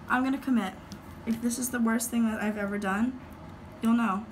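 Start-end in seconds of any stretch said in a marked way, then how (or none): none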